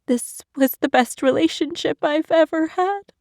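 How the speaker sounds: high, wavering words through sobs; sobbing. Acted. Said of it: "she sobbed through her statement".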